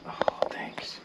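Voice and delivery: soft whispered thanks; hushed, voiceless answer; whispering. Real, not acted. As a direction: whisper a modest reply. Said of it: "we're whispering now".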